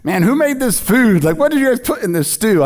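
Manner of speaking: high, loud mock disbelief; baffled disciples. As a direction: ham up the puzzled followers. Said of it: "they didn't believe it".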